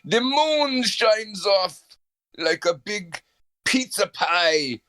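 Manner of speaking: slow, brooding mock Dracula telling his tale; Dracula monologue. For the friend, a slow hammed-up Dracula monologue. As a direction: drawl it out like a hammed-up vampire. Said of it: "set the scene where you are Dracula".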